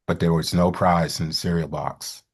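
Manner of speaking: ordinary delivery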